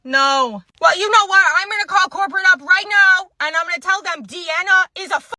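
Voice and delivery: high, loud indignant threats; angry customer rant. Acted. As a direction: rant like an outraged customer, pitch climbing. Acted furious complaint, threat to call corporate.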